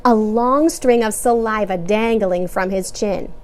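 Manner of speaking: ordinary delivery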